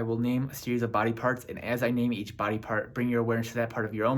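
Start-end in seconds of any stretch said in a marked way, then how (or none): none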